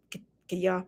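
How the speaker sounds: ordinary talk, nothing marked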